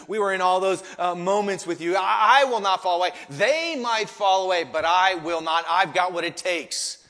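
loud, insistent vow of loyalty; boastful self-confidence. Acted. Peter standing right before Jesus, cocksure and loud. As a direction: boast it firmly, stressing each claim. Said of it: "They're dripping with self-confidence".

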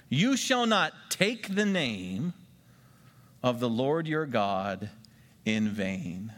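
slow, spaced-out recitation; deliberate memory-verse repeat; slow repeated dictation. Shown as a demonstration. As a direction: say it again slowly for note-takers. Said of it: "Write that down".

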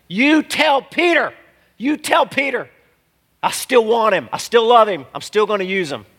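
preaching Jesus' message with rising fervour; fervent preaching. Acted. Preacher voicing Jesus to Peter, fervent high plea.

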